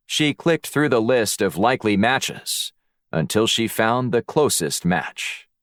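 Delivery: usual speaking voice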